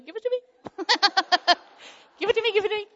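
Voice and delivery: high, laughing give-me squeal; eager grabbing. Retold or imitated. Mom grabbing the neighbour's eggs, high laughing squeal. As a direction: squeal for them like a gleeful kid.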